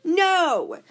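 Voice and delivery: loud, high no; emphatic no. Real, not acted. Loud no shouted at parents about the vaccine. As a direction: cut them off hard.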